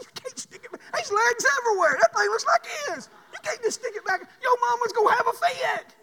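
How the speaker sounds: very high-pitched mock alarm; frantic protest. Dad objecting to daughter's sloppy folding, frantic mock protest. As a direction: act out a dad in a comic panic.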